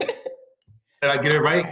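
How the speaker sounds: normal talking voice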